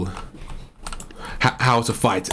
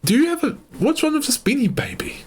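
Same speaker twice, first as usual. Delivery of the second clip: toy voice; 13 semitones higher than usual. Acted. High voiced plush toy character.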